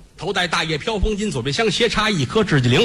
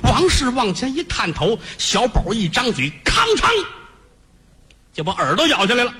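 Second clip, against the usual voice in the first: ordinary delivery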